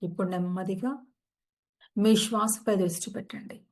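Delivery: calm, unhurried meditation guide; soothing yoga nidra guidance; guided meditation. Acted. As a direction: ease listeners into stillness.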